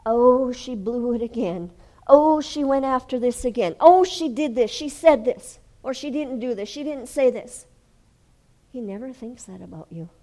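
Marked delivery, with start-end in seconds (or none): 0.1-7.6 loud, high nagging complaint; loud mocking nagging voice; mock accuser. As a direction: rant like a faultfinder. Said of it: "He doesn't go".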